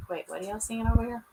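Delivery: normal talking voice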